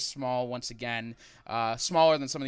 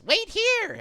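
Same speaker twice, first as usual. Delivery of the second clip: very high, loud squeaky yell; little creature yelling; 9 dB louder than usual. Acted. Alliamite running inside to visitors, high yelled order. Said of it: "You hear one of them yells".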